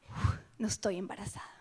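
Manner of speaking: a soft "Uf" of relief; relieved sigh. Retold or imitated. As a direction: let out the relief. Relief on getting period.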